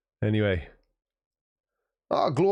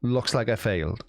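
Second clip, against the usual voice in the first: usual speaking voice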